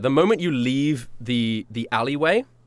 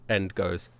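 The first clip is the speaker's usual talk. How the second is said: ordinary talk, nothing marked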